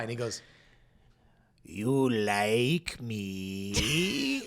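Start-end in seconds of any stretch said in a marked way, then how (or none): 1.6-4.4 very slow, drawn-out mock Middle Eastern accent; punchline in a long-drawn imitated Middle Eastern accent. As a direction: stretch each word right out, imitating a Middle Eastern client's accent. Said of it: "I don't know what his accent is".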